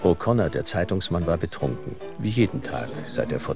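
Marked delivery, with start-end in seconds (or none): none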